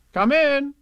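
high, loud singsong call. Acted. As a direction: call to someone at the door. Kanga calls to the door.

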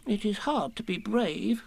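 high, soft, slow, a touch tearful; a small voice close to sniffles; sniffing Piglet. A narrator's reading. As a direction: Piglet sniffing as he defends himself. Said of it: "sniffing slightly".

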